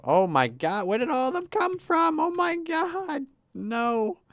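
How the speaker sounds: high squeaky panicked voice, stretched slowly; mock panic squeal. Streamer swamped by customers, squeaking in mock panic. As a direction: squeal in comic panic at the crowd. Swarmed by customers in cooking game.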